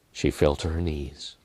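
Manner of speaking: plain reading voice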